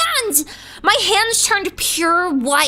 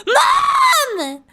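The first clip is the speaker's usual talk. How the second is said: high, slow, urgent calling for mom; frantic yelling; 8 semitones higher, slower than usual. Retold or imitated. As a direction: yell for mom in mock panic. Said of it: "I was like".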